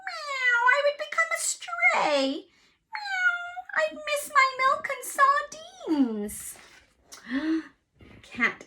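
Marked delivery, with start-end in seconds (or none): 0.1-6.4 anxious farm cat. Acted. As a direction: squeak it out as a worried cat. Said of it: "said Cat".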